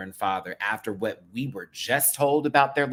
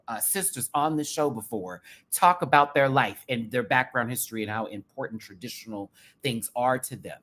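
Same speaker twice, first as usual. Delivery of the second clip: ordinary talk, nothing marked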